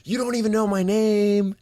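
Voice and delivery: high-pitched playful mock yell; taunting. [name] provoking the irate woman, high taunt. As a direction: call it out as a goading jeer.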